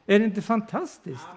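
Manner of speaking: normal talking voice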